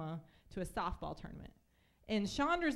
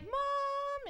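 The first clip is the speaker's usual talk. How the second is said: put-on much higher call of a kid for mom; whiny kid call. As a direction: wail for mom like a little brother. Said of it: "he was like".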